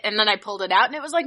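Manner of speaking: usual speaking voice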